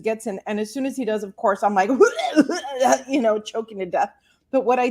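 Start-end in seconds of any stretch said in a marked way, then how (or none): 1.9-3.0 laughter. Real, not acted. Laughing at own story.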